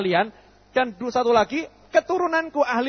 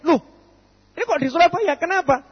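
high, louder startled loh; surprised; 8 semitones higher, 4 dB louder than usual. Acted. Lost traveller startled to find himself in Surabaya. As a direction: act out the shock of ending up in the wrong city.

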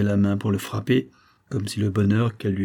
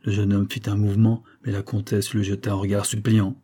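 plain reading voice

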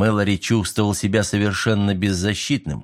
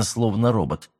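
ordinary delivery

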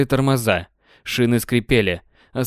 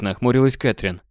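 usual speaking voice

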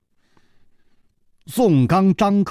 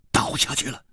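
usual speaking voice

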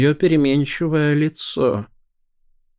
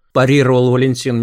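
ordinary delivery

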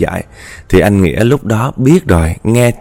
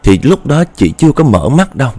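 ordinary delivery

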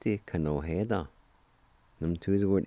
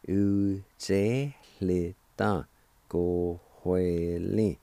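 u te le ta ko hue le said slowly as one word; slowly joined; much slower than usual. Shown as a demonstration. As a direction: draw the whole word out slowly so learners can follow. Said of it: "And together".

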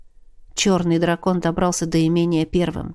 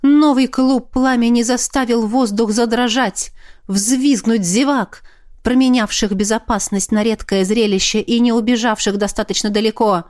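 ordinary delivery

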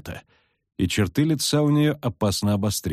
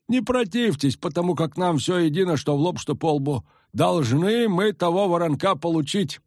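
high, folksy village peasant; rustic old villager, eager; 9 semitones higher than usual. A narrator's reading. Peasant explaining.

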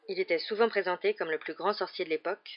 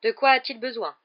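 sharp, clipped question, a bit louder; curt tone; 5 semitones higher, 8 dB louder than usual. Acted. McGonagall questioning the nurse, curtly. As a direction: cut straight to the point. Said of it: "d'un ton cassant".

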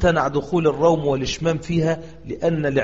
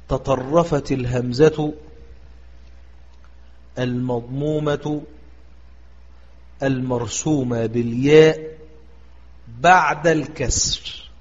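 إملاء بطيء؛ يقرأ القاعدة ببطء وتأنٍّ؛ قلها كلمة كلمة للحفظ؛ إملاء الضابط ببطء للطلاب; 2 semitones lower, slower than usual. Shown as a demonstration. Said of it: "ما ضابط هذا الباب؟".

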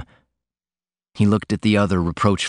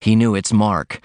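usual speaking voice

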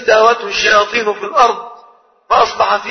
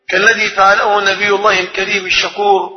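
normal talking voice

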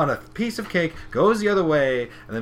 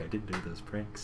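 tiny, much softer, lower voice; mock nervous denial; 5 semitones lower, 14 dB quieter than usual. Pinkie nervously denying she did the pranks, meek. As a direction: shrink into a meek little denial. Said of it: "she has that nervous smile like".

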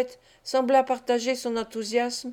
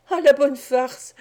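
gleeful laugh; 3 semitones higher, 6 dB louder, slower than usual. Acted. Bates delighted by the scene.

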